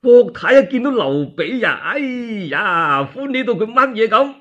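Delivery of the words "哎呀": ordinary delivery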